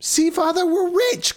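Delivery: put-on high, giddy girl voice; laughing and crying. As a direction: gush like a teary happy girl. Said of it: "laughing and crying at the same time".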